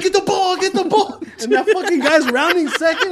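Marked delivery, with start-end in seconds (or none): 0.0-1.2 urgent shouting. Retold or imitated. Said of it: "I was like".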